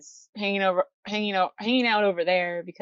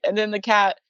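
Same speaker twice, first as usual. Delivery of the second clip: ordinary delivery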